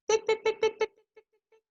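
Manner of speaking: very high, quick picks; fast high heel clicks. Retold or imitated. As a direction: rattle them off like running heels. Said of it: "The lady starts to run".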